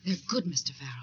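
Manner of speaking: hushed, breathy insistence; urgent whisper. Acted. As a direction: whisper it close to him. Pressing her samples on him.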